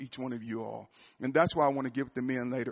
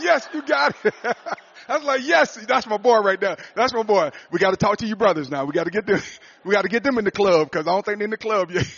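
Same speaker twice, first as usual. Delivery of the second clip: much higher, much louder joyful shout; excited shout; 9 semitones higher, 14 dB louder than usual. Retold or imitated. Father cheering his son joining the crying club, loud excitement. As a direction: cheer like your son made you proud.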